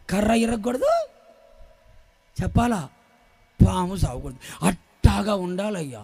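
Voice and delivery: low, hushed counsel with pauses; imitated cautious adviser. Church member advising the pastor to be lenient, low cautious imitation. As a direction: softly advise looking the other way.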